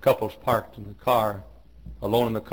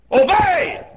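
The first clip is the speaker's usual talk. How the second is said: very high, loud cry of one word; full shouted command; 8 dB louder than usual. Real, not acted. Preacher commands the young to obey, shouting. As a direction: hurl the word out at the top of the voice.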